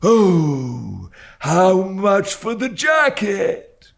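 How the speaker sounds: put-on high, slow sing-song old man's cry; a wailing old crank haggling. A narrator's reading. Old dealer asking the boy the price, wailing. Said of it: "cried the old man".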